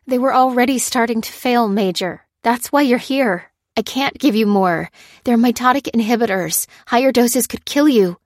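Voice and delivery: high, loud, urgent explanation; willing him to believe. A narrator's reading. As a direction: reason with him insistently. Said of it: "willing him to believe her".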